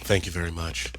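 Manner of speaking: low-key mimicked acceptance; curt award acceptance. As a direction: imitate an understated award winner. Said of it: "He just got his award".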